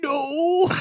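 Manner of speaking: high, squeaky drawn-out denial; mock guilty denial. Caught taking a picture, mock guilty denial.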